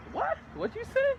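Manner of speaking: high startled question; high incredulous what; shocked what. Retold or imitated. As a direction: react in disbelief, high. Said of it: "I was like".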